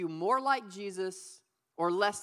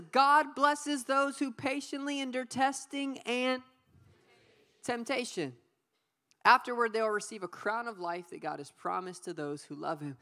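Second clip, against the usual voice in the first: scripture reading. Acted. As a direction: read it like Scripture, unhurried. Reads verse twelve aloud.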